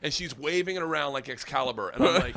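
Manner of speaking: ordinary talk, nothing marked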